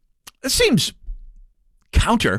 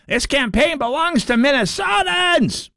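slow, much higher, shouted rally cry; hammed-up shouted slogan, higher and slow; mock shout; 13 semitones higher than usual. Shown as a demonstration. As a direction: belt it out like a rally chant. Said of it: "I'm sorry, Peggy Flanagan said it".

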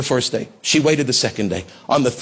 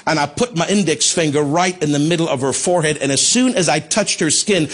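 ordinary delivery

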